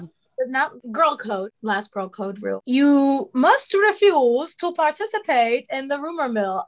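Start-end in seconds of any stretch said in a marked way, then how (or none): 2.7-6.6 slow, theatrical wizard-film voice; hammed-up, drawn-out wizarding decree; Harry Potter. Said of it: "say that in a Harry Potter voice".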